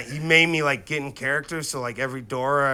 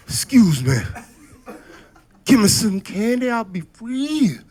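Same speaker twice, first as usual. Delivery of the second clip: mock pitiful plea; 8 semitones higher, 6 dB louder than usual. Shown as a demonstration. Boy begging at the first door, hammed-up plea. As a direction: plead like a pitiful slave. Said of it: "I did it all".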